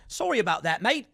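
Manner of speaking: put-on light Aussie accent, casual apology; a hint of an Australian mate saying sorry; Australian bloke. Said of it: "Or I think that was Australian".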